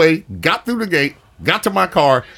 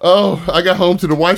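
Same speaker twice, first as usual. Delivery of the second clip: usual speaking voice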